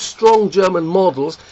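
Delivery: normal talking voice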